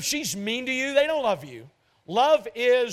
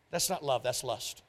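low grave verdict; 7 semitones lower, 6 dB quieter than usual. Real, not acted. Preacher drops voice for verdict.

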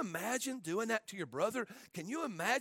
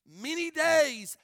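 slow, high, loud repetition; emphatic cry; 9 semitones higher, 10 dB louder, much slower than usual. Real, not acted. Preacher stressing how long the father mourned, emphatic cry. Same words repeated at full force.

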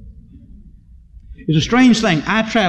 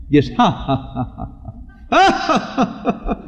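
mock laughter; 8 semitones higher, slower than usual. Imitates convert's laughter.

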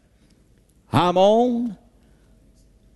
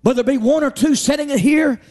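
high, fervent sermon voice, slowing into it; preaching at full force; 9 semitones higher, faster than usual. Acted. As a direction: preach it up, building.

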